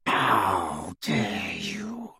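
slow, breathy whispered snarl. A narrator's reading. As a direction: snarl it low through teeth. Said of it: "he snarled".

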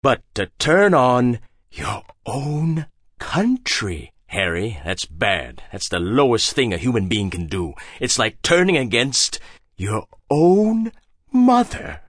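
a hint of a stern father; scolding father. A narrator's reading. Hagbard shaming Harry like his father, slow scolding.

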